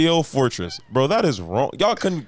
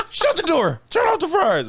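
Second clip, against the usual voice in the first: laughing high-pitched panic; shrieking through laughter; panicked fast food worker. Pretend. Burger King worker panicking.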